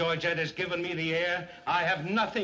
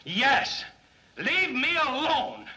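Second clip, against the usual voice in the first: high, loud snap; angry high snap; 9 semitones higher, 4 dB louder, slower than usual. Acted. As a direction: tell him to leave you alone. Snapping at friend.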